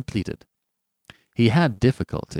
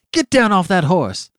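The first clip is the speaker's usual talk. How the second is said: furious high-pitched order; ferocious; 9 semitones higher, 6 dB louder than usual. A narrator's reading. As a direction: shout the command ferociously. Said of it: "in a ferocious voice".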